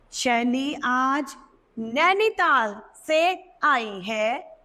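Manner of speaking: slow, spaced classroom read. Shown as a demonstration. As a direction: spell it out slowly for young pupils. Teacher reads example sentences.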